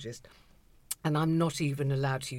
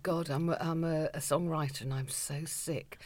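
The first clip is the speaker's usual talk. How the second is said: weary songwriter impression; 6 semitones higher, 9 dB louder than usual. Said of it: "Dilly is giving us that fabulously slightly old-fashioned look".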